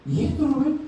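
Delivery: a woman stunned by her own Bible; mock astonished woman's voice; astonished lady.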